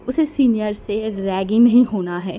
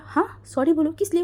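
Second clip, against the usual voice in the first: mocking; 7 semitones higher than usual. A narrator's reading. Mocking back at senior.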